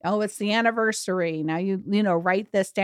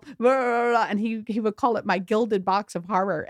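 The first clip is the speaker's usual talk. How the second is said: normal talking voice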